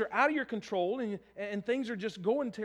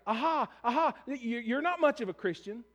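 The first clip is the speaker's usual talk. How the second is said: mocking accusers; 3 semitones higher, 5 dB louder than usual. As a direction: sneer at the fallen believer. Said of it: "people are pointing the finger".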